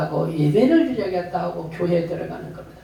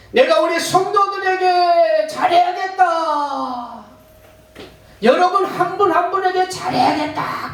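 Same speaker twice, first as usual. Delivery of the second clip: high, loud preaching voice, slowing on the gift; full-force preaching; 12 semitones higher, 4 dB louder than usual. Acted. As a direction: proclaim it to the congregation. Resolves before the congregation. (높고 힘찬 설교)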